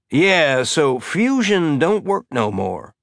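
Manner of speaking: slow, stretched-out Southern drawl laid on thick; a Southern drawl played up for effect; exaggerated drawl. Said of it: "exaggerating his drawl".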